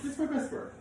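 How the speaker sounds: high mock-offended squeak; mock indignant. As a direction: defend it in a put-on high voice. Mock defence to wife.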